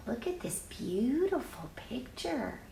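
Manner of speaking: usual speaking voice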